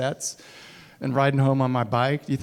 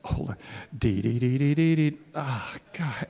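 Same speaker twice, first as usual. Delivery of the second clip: nervous newbie. Retold or imitated. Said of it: "you'll be like this".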